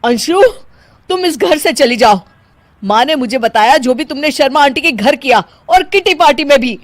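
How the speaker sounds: husband ordering his wife out; angry order. Acted. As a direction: throw her out of the house. Husband angrily orders her out.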